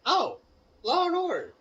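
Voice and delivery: high, loud mock-knowing jab; the coach teasing the student over his test answer, sarcastic. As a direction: toss it off with a sarcastic wink. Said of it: "He had a sarcastic tone in his voice".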